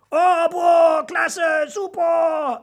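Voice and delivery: loud, high, stretched-out shout; enthusiastic cheer. A narrator's reading. Audience roars at the dragon's fiery blast, thrilled. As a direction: whoop like a thrilled crowd. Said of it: "ertönte es begeistert aus dem Publikum".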